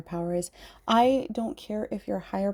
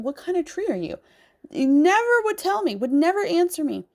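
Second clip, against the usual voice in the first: pleading with the trees; 9 semitones higher, 6 dB louder than usual. Retold or imitated. Retelling asking the trees.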